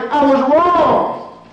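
loud, high, very slow "wrong" stretched out; mock confession. Repeated confession quote, loud slow take.

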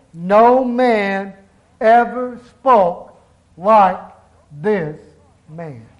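each word spaced out, ending in a hush; slow solemn emphasis. Acted. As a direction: let the words fall one by one, fading at the end. Officers' awed reply, read slowly.

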